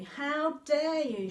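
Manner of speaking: high, loud, slow outraged cry; angry cry. A narrator's reading. Girl finds witch eating the picnic, outraged cry. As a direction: let the outrage burst out. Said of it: "she cried".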